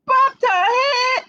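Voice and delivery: high, slow, loud pretend cry; mock alarm. Librarian reading to kids, a pretend crying shout. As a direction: wail it like a hammed-up little disaster. Bunny falls in story.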